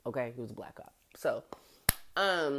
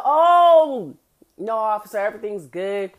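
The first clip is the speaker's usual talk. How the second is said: suddenly sober; 2 semitones higher, 8 dB louder than usual. Retold or imitated. Drunk friend answering the cop, startled and overly polite. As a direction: snap to attention and sound sober for the cop. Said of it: "He's like".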